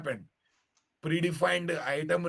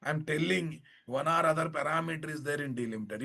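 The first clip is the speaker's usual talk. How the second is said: usual speaking voice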